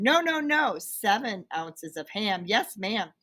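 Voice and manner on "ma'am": usual speaking voice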